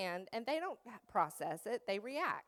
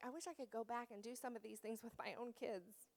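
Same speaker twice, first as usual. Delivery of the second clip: ordinary talk, nothing marked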